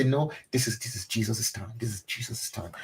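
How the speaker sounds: calm yourself back to focus; quiet self-reminder. Retold or imitated. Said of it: "I say".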